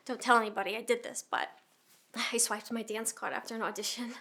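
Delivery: low, hushed admission of a small crime; confiding. Acted. Dancer owning up to swiping her card, confiding.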